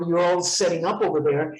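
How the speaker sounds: ordinary talk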